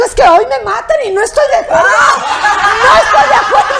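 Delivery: loud, high-pitched mock hysterics; comic shouted imitation of outrage; hammed-up wailing protest. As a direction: wail it like a distraught diva. Acting out Felicia's panicked protest.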